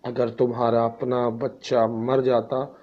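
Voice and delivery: ordinary talk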